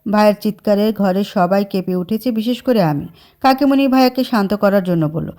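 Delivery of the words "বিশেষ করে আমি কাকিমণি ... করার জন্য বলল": usual speaking voice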